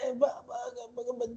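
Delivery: high, soft stuttering; stammering kid. Acted. Yamu trying to think what to say, stammering. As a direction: stall in a small, high child's voice. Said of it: "trying to think of what to say".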